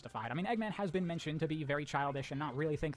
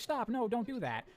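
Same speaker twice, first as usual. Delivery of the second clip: pleading Amy; 7 semitones higher, slower than usual. Retold or imitated. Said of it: "Amy's like".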